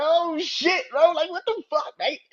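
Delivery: high, loud, stunned yelling; a fan losing it at a wrestling move; shocked excited outburst. Retold or imitated. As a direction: blurt it out like you can't believe what you just saw. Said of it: "I was like".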